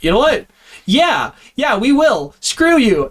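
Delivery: high, fired-up defiance; defiant Pike. Retold or imitated. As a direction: cheer back a bold yes. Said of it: "And Pike's like".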